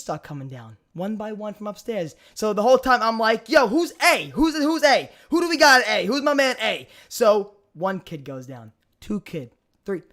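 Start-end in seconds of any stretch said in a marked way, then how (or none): 3.5-6.9 raised, higher mock hollering; retold calling out. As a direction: playfully call round the room for someone.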